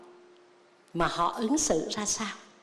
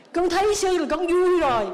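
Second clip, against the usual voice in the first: higher, louder gushing devotee; hammed-up, higher gush of joy; mock devotee; 8 semitones higher, 4 dB louder than usual. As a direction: gush like a delighted follower. Monk mocks a contented follower.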